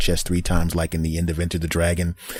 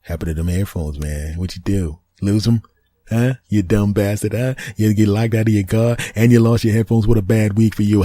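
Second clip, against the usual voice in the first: louder teasing ribbing; mocking friend; 4 semitones higher, 5 dB louder than usual. Friends teasing him about lost headphones, louder ribbing. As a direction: razz him like a buddy. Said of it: "they're going".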